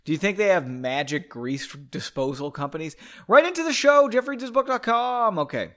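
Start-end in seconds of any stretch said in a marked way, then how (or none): 3.3-5.4 loud, high mock announcer plug; big, high-pitched promo shout; show plug. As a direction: sing out the show's address like a jingle.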